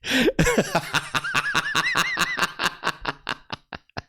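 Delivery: breathless high-pitched cackle; real helpless giggle fit; high wheezing laugh. Real, not acted. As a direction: lose it laughing. Cracking up at his own story.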